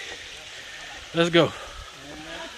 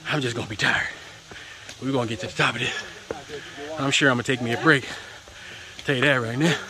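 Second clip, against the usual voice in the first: ordinary delivery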